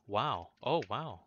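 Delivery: genuinely impressed; amazed. Real, not acted. Sees new area.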